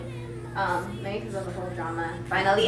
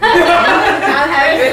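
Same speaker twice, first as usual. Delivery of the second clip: laughing; 6 semitones higher, 15 dB louder, much slower than usual. Real, not acted. Laughing about her character.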